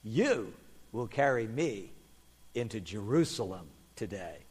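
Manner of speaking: low, soft, measured voice; calm and kindly. Acted. Jesus tells the young donkey of his task, calm and kindly. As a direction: say it gently, with quiet assurance.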